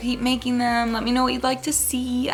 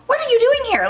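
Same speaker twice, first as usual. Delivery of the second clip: very high and louder demand; confused shock; 14 semitones higher, 5 dB louder than usual. Retold or imitated. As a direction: blurt it out, startled and loud. Confronting girl at the door.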